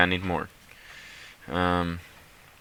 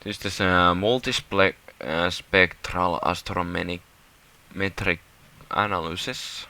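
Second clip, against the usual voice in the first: usual speaking voice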